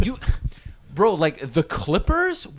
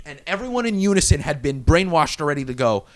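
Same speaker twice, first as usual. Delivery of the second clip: usual speaking voice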